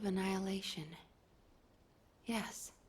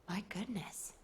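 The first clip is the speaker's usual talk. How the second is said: breathless hush; 3 semitones lower, 7 dB quieter than usual. Acted. Officer stunned by the story.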